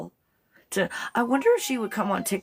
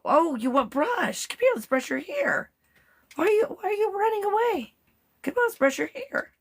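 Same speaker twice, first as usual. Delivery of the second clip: high, sing-song coaxing; much higher sweet pet voice; 13 semitones higher than usual. Real, not acted. Coaxing the dog to brush.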